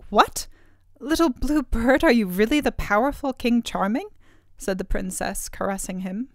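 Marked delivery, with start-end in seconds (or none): 0.1-4.1 higher, wondering astonished question; surprised. Acted. Princess discovering the bird is the king, astonished. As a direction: gasp in disbelief at the bird.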